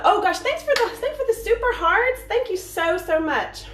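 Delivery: high, delighted squeal of gratitude; very high, thrilled thank-you; gushing thanks. Real, not acted. Gushing thanks to viewers for hearts.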